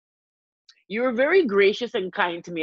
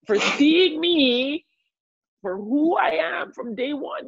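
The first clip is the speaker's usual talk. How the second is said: emotional, near tears; 9 semitones higher, much slower than usual. Real, not acted. Thanking her friend, close to tears. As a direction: voice lifting and slowing as emotion rises. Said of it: "I feel emotion behind my eyes".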